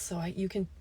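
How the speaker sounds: normal talking voice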